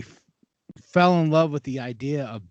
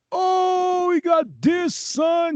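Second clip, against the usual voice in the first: much higher, shouted hype; hyped shout; much slower than usual. Retold or imitated. Mom and the audience cheering the final battle, high hyped shout. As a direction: shout it like the theater just erupted.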